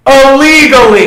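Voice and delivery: loud, high, drawn-out interjection; joking shout. Pretend. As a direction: blurt it out as a loud punchline. Shouted joke completing the friend's line.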